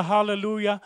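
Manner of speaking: normal talking voice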